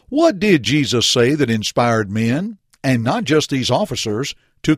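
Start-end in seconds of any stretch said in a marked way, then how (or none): none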